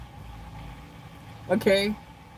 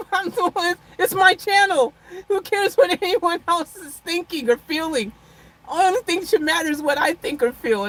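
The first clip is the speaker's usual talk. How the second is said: mock self-centred; 5 semitones higher than usual. Vlogger teasing her live chat, mock self-centred. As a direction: ham up being all about yourself. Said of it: "I'm just being funny".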